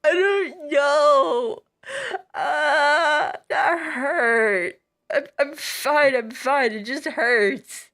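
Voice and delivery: teary. Acted.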